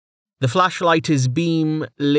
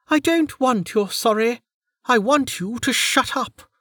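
Lady Draves, curt; 12 semitones higher than usual. Acted.

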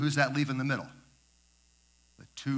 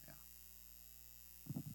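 choked up; 23 dB quieter than usual. Real, not acted. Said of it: "I get a little emotional".

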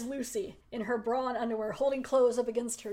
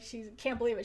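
ordinary delivery

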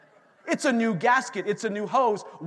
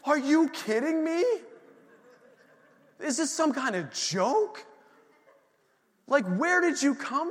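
higher, incredulous rant at the birds; mock disbelief, throw it at the sky; exasperated; 8 semitones higher than usual. A man on his prayer walk venting at noisy crows, exasperated and higher. Ranting at crows following him.

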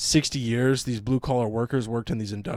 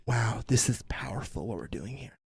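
hushed, self-important filmmaker; mocking breath of reverence; mock awed whisper; 3 semitones lower, 8 dB quieter than usual. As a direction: whisper it as if overcome by your own work. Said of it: "the whole time they're making this".

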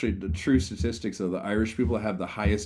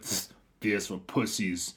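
slow, softer scoff; a Russian guy in a bar, scoffing, slow and soft; scornful dismissal; 5 dB quieter, much slower than usual. Retold or imitated. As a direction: scoff at the beer offer, slowly. Russian guy's scornful reply.

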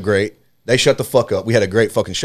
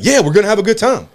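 high-pitched mock heckler reply; imitated heckler comeback; 11 semitones higher, 4 dB louder than usual.

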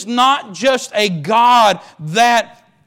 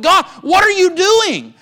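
anguished cry to God; 8 semitones higher than usual. Acted. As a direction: curse God in open anguish. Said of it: "cursed God and said".

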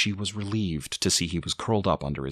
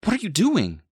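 high, loud yelp of protest; startled pain; 5 dB louder than usual. A narrator's reading. Mother bitten by her baby, startled yelp. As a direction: cry out, startled and hurt.

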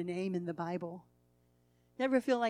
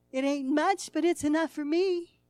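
higher, louder proclaiming; emphatic declaration; 5 semitones higher, 6 dB louder than usual. Acted. Preacher declaring the boy's claim, loud. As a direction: announce it firmly to all. Boy clutching his picnic.

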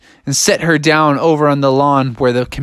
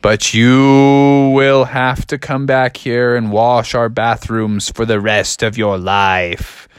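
drawn-out, ominous warning; stern warning; 5 semitones lower, slower than usual. Acted. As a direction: draw out the threat slowly. Gummies threaten a punishment.

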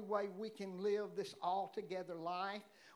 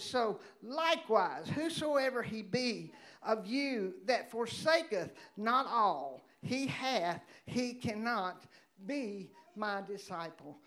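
preaching; 5 dB louder than usual. Acted. Preaches at full force.

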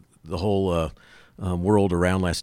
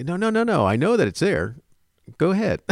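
high-pitched string of protests; mock insistent objection; 9 semitones higher than usual. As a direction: imitate a cocksure doubter, pitched up. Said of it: "easily see somebody going".

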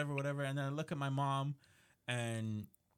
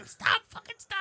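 mom laughing protest; 5 dB louder than usual. Retold or imitated. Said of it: "She's like".

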